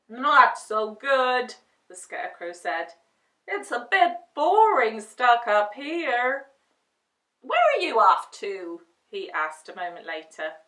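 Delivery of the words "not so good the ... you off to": scarecrow character voice. Acted. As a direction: drag out the scarecrow's words, bored.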